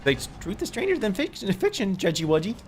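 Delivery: sing-song it like a cartoon; playful mock nickname; silly high tease. Teasing silly voice.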